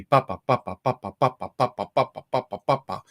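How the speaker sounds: steady pa syllables in twos, strong then weak; even duple pulse. Shown as a demonstration. Dulcimer teacher chanting the reel rhythm for students, evenly in twos. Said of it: "everything comes down into twos".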